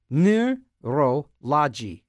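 Neurology said very slowly, syllable by syllable; slow careful try. Shown as a demonstration. DJ sounding out a tricky word, slow careful try. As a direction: stretch Neurology out to get it right. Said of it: "Can't say neurolo".

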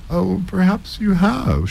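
high, slow yawning reply. A narrator's reading. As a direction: say it through a bored yawn. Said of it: "she said, yawning".

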